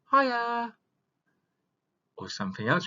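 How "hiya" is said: bright greeting. Shown as a demonstration. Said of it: "when we greet somebody".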